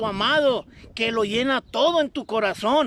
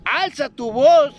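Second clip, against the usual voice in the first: slow, much louder call to lift the voice; loud proclamation; 7 dB louder, slower than usual. Acted. Preacher relays God's command to the people, slow and loud. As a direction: ring it out like a trumpet.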